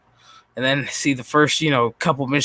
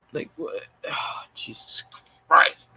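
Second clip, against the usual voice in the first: whispered Jesus Christ under the breath; despairing whisper; 5 dB quieter than usual. Real, not acted. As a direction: let it out as a hopeless whisper. Muttering in despair at the panel.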